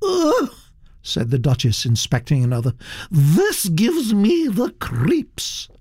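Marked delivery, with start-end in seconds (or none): 0.0-0.5 high-pitched groan of distaste; Duchess's disgusted noise; disgusted ugh. Acted. Duchess recoils from a painting. 3.1-5.7 high, slow, drawn-out distaste; imperious, high-pitched shudder of disapproval; disgusted Duchess. Acted.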